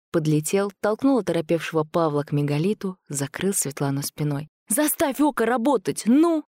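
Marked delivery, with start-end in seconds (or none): 4.7-6.4 high, pressing shout; urgent command. A narrator's reading. Man shielding girl from creatures, urgent command.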